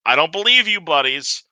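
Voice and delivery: put-on high, broken Sharn accent; strong Sharn accent. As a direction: push a thick Sharn accent. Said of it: "in a very strong Sharn accent".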